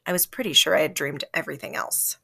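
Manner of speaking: plain reading voice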